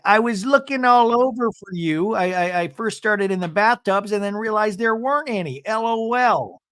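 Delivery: high, goofy husband imitation; Seth, jokey. Seth arriving to find his wife, high goofy mimicry. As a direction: mimic a chipper man pitched well up. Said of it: "And Seth's like".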